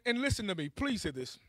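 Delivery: normal talking voice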